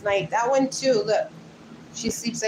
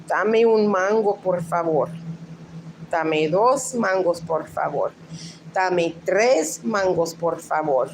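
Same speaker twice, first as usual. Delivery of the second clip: Spanish accent. Shown as a demonstration. Showing Luann the word in use, Spanish accent. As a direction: demonstrate it in measured Spanish phrases. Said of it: "how I grew up pronouncing it".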